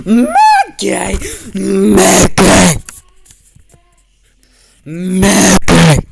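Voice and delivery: very loud mock Minnie yell. Playing Minnie chasing after Mickey, loud yelled calls. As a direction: holler the name at full force, play-acting.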